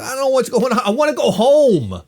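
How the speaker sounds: put-on high, whiny child's plea; scared little kid. Six-year-old at the drive-in begging to go home, scared whine. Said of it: "I said".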